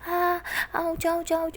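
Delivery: high pained cry of aah; short high yelps after a stomped foot. A narrator's reading. Siya yelps as Tara stomps her foot, pained cry. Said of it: "Siya ज़ोर से चिल्लाती है".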